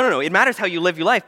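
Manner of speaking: ordinary talk, nothing marked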